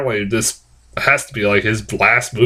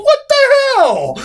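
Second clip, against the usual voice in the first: high squealed disbelief that drops at the end; incredulous yelp, laughing at the end; retold yell. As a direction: re-enact yelling out in bafflement. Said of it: "I yelled in the middle".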